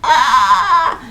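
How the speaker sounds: a playful story scream; mock scream. Babysitter sees the ghost, a mock scream. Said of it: "The babysitter screamed".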